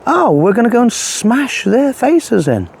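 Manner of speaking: slow, high-pitched mock repeat; drawn-out sarcastic echo. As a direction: parrot it back slowly and high, in ridicule. Mockingly repeating the quote just given.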